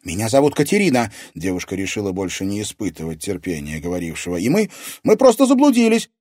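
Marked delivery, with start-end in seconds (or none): 0.0-1.1 put-on quick, louder, slightly raised girl's voice; hasty young heroine's reply; girl answering captor. A narrator's reading. Captive girl answers the villain. 4.4-6.1 high, fast, stumbling plea; loud, flustered girl's voice; nervous excuse. A narrator's reading. Nervous excuse to the threatening villain.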